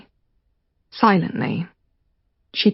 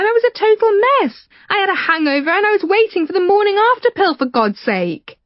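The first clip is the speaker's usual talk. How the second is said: much higher, fast indignant woman; Rachel exasperated; 10 semitones higher than usual. Acted. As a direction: scoff and rush it out, high. Rachel scoffs at his fantasy.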